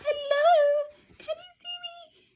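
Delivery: very high, small mouse calling out; squeaky mouse voice. Acted. Teacher voicing the mouse greeting the others in a squeaky voice. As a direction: peep it out like a tiny mouse. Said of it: "he's saying".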